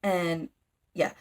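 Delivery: usual speaking voice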